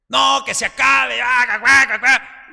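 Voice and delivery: high, loud, hammed-up wailing refusal; mock angry spouse. Pastor mocks a spouse refusing to reconcile, loud and high. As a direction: play the stubborn spouse, overdone. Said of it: "spouse refusing in mock drama".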